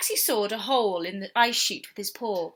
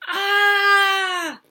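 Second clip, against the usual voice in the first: high shocked shriek; startled scream; 9 semitones higher, 7 dB louder than usual. Acted. Walrus shrieking in fright at the cub's boo. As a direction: yelp as if jumped on. Cub terrified.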